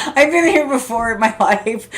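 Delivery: laughing through the words; real laughter at her own memory. Real, not acted. Laughing while telling viewers about her past. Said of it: "isn't that funny?".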